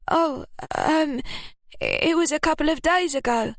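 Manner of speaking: high, hesitant, caught out; flustered Ruby. A narrator's reading. Ruby fumbling her answer to Lily's question. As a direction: stall for time.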